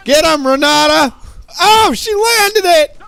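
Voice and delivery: high, loud cheer for the play; excited shout. Real, not acted. Streamer cheering his Renata's hook, loud and high. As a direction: yell it out as the combo lands.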